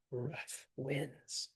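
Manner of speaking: "Rough" and "winds" stretched with equal weight, softly; spondee. Shown as a demonstration. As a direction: hit both "Rough" and "winds", slowly. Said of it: "we wanna hit both those".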